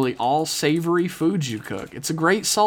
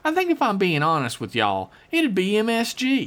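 folksy hillbilly letter-writer; 6 semitones higher than usual. Acted. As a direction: read it as a rambling backwoods yokel. Reads listener email in character.

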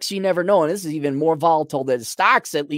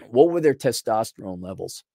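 ordinary talk, nothing marked

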